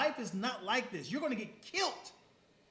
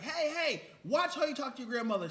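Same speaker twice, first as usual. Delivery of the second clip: put-on scolding old woman; 5 semitones higher, 6 dB louder than usual.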